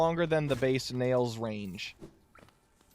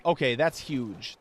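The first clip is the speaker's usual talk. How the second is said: usual speaking voice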